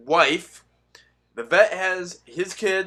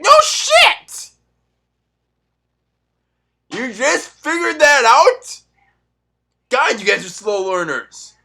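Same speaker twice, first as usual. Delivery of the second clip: much higher and louder, a slow sarcastic shout; mocking yell; 9 semitones higher, 9 dB louder, slower than usual. Vlogger shouting sarcasm at regretful Biden voters. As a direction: yell it right in their faces. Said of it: "I was like".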